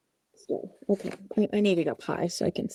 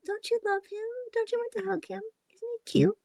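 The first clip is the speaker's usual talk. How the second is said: high doting coo; 13 semitones higher, 5 dB quieter than usual. Real, not acted. Cooing over the cute puppet.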